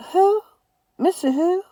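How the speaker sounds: high, surprised question; startled daughter. A narrator's reading. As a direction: ask in disbelief. Mama voiced surprised.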